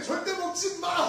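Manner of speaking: high, slow, emphatic scolding; stern prohibition. Acted. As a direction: mimic a grandmother forbidding the candy. Grandmother's candy prohibition, acted out.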